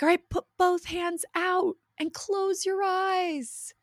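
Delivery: high, slow, drawn-out instruction; riding instructor voice. Retold or imitated. Mom imitating instructor to riders, high slow call. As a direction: stretch the directions out in a high voice. Where they're like.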